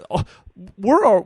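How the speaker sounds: normal talking voice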